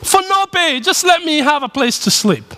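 high, pleading, desperate voice; begging for my life. Retold or imitated. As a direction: beg the father for mercy. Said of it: "he's just begging for mercy".

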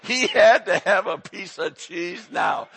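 loud, slow, laughing through the words; cracking up so hard he shouts it; laughing loudly. Real, not acted. Preacher cracking up about grandson's cheese demand, loud laughter.

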